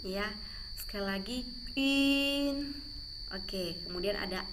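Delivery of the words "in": long, high "in" through rounded lips; slow "in", vowel between i and u; fish-eye ün. Shown as a demonstration. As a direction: pout and hold "in" as ün. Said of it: "ini juga U mata ikan dan N".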